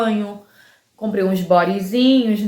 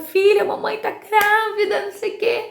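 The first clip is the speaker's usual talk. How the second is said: very high-pitched, thrilled mom voice; retold excited announcement; 12 semitones higher than usual. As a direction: break the big news in a high squeal. Retold waking daughter with the news. (anúncio empolgado em voz bem aguda)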